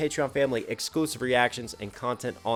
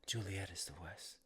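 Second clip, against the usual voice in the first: soft lines recalled from memory, trailing quieter; hushed recital; 3 semitones lower, 12 dB quieter than usual. Acted. Reactor recalling Romeo and Juliet lines, hushed recital. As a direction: recite it softly, as if remembering.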